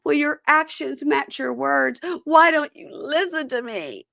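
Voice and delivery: high, hammed-up wounded demands; Amani's dramatic pleading. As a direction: implore like a mock soap-opera heroine. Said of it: "Amani is like".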